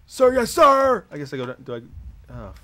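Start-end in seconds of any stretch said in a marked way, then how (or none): 0.0-1.0 shouting recruit. Pretend. Recruit answering drill sergeant.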